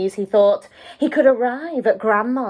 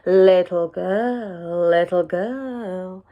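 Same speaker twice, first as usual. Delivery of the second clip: lower, slow silky calling; deep silky wolf; 3 semitones lower, much slower than usual. A narrator's reading. Hidden wolf calling the girl from the trees, deep and silky. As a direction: lure her in slowly like a sly wolf. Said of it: "deep, silky voice calling".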